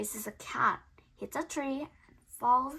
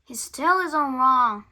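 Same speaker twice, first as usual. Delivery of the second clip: Big's voice; 11 dB louder than usual. Acted. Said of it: "says Big".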